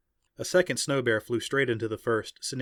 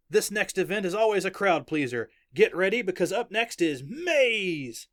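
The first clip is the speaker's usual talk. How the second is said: excited announcer; 8 semitones higher, 4 dB louder than usual. Acted. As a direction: hype up the crowd, stretching the event's name. Announcer introduces the next event.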